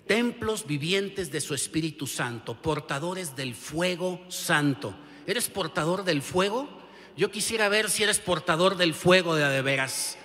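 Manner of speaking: loud, rising preacher's challenge; building revival shout ending in a roared amen; fiery preaching. Acted. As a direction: preach it full force and fire up the crowd.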